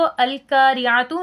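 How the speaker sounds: "अल-क़ारिअतु" said with a light front k, as al-kaariatu; quick, unchanted "अल-क़ारिअतु" with thin kaa for qo; wrong thin kaf. Shown as a demonstration. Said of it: "नहीं पढ़ेंगे क्योंकि ये गलत".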